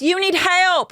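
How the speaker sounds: high, hammed-up retold accusation; mock outrage. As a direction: hurl it back as her, high. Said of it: "She goes".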